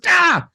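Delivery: high, loud cry of frustration; frustrated yell. Retold or imitated. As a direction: let out a fed-up yelp. Character frustrated by rhyming giant.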